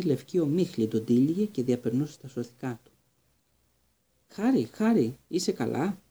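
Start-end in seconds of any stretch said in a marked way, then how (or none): 4.3-6.0 higher anxious calling of the name; worried calling. A narrator's reading. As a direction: call him back, worried. Friend calls fainted Harry. (ανήσυχο κάλεσμα στον Χάρη που λιποθύμησε)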